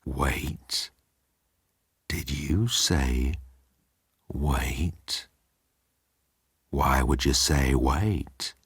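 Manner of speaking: low, soft and slow menace; quietly menacing villain; low drawn-out suspicious query; menacing Jeltz. Acted.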